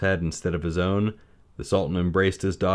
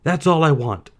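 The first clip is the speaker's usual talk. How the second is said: higher, louder pleading plea; begging; 9 semitones higher, 7 dB louder than usual. A narrator's reading. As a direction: beg with desperate longing. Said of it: "he begged".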